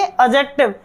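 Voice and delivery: "एडजेक्टिव" as ajektiv; silent D. Shown as a demonstration. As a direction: swallow no syllable, only omit D in "एडजेक्टिव". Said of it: "उसको कभी नहीं बोला जाता". ("एडजेक्टिव" को अ-जेक्टिव बोलें)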